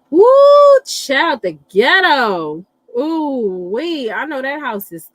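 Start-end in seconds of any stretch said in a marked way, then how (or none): 0.1-0.8 loud high-pitched woo of disbelief; high whoop. Real, not acted. Reacting to chaotic household. 0.9-4.1 drawn-out sass. Real, not acted. Reacting to chaotic household.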